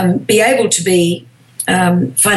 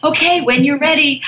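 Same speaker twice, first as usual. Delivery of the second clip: casting director's cue; 7 semitones higher than usual. Retold or imitated. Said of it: "And then they say".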